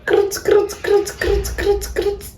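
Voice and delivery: high squeaky repeated krut noise; high cartoon gasp sounds; mock gasping croak. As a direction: squeak krut like a dropped jaw. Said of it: "Jaw drops".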